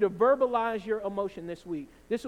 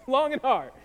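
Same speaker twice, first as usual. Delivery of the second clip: long and hard, louder through a laugh; amused, emphatic; 3 semitones higher, 7 dB louder than usual. Real, not acted. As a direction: laugh while punching the repeated phrase. Repeats own phrase for a laugh.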